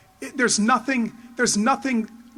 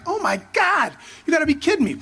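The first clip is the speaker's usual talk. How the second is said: higher, giddy disbelief; gushing like a starstruck kid; 6 semitones higher than usual. Retold or imitated. Hearing about the McMahon appointment, giddy and starstruck. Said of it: "I'm like".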